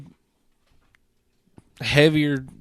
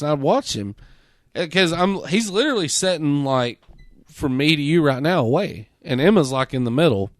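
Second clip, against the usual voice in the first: ordinary talk, nothing marked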